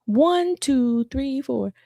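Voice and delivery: imitating a child slowly reciting numbers; slow repetition. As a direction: repeat it slowly, one digit at a time. Child repeats the code on request.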